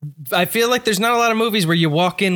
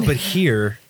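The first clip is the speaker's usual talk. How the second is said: ordinary talk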